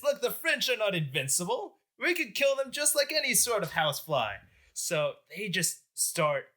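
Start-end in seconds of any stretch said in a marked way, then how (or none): 0.0-4.4 high, cocky mock boast; rally your mates with raised pitch; emboldened. Rioters who have finally spilled blood, emboldened boasting.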